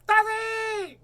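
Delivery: put-on high, loud child calling for daddy; little girl calling. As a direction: shriek it like a small girl spotting dad. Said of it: "That sounds like her".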